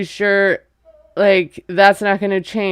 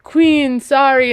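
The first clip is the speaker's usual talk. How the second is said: high, loud mock apology; retold retort, pitched up and punched out; 5 semitones higher, 5 dB louder, slower than usual. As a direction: toss out a loud, high correction.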